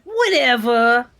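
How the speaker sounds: drawn-out whatever. Retold or imitated. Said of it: "As Mindstab just said".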